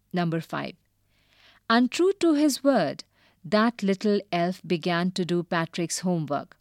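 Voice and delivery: usual speaking voice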